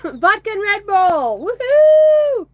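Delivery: loud, high, slow cheer for the drink; party shout. Pretend. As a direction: yell it like a toast at a bar.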